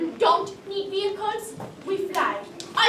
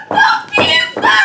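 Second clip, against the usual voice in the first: high, slow triumphant shout of the pizza; a herald crying out the prize; grand shout; 10 dB louder, slower than usual. Acted. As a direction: hail the treasure at full voice. Grand reveal of quest item.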